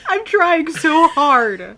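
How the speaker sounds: high, loud, drawn-out protest through laughter; cracking up while struggling to hold it in; stifling laughter. Real, not acted. Game master trying so hard at player's voice, helpless laughter.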